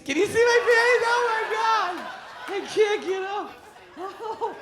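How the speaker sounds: put-on high, loud shrieking drunk; drunk wife. Wife on the floor shrieking to the crowd, high loud drunk voice.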